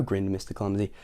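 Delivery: usual speaking voice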